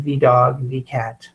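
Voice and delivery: "the" as "thee" before dog and cat; long ee in "the", spoken as thee; old "thee" pronunciation. Shown as a demonstration. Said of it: "we used to say V everything".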